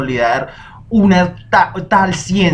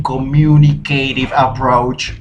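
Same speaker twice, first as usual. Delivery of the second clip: slow English term; slower than usual. Real, not acted.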